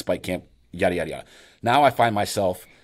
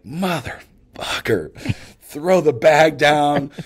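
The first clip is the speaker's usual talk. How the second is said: slow, higher, louder drawn-out curse; cussing outburst; 7 semitones higher, 4 dB louder, much slower than usual. Retold or imitated. Hunter finding bugs in his breakfast, a slow loud curse. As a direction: let the swear stretch out in disgust. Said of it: "I'm about to cuss".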